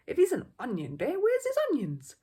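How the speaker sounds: loud, high triumphant gotcha. Acted. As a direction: crow it like you've won the argument. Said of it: "he added triumphantly".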